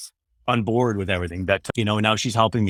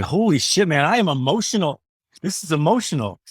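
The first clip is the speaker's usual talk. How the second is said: high, choked-up disbelief; re-live being moved to tears; overwhelmed; 5 semitones higher than usual. Retold or imitated. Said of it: "lump in my throat and going".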